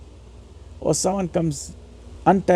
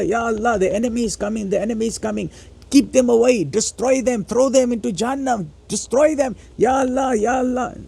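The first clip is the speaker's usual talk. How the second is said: imitated pleading prayer; 5 semitones higher than usual. Preacher acting out a frantic prayer, pleading cry. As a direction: beg God to destroy the enemy. Acted desperate prayer, raised.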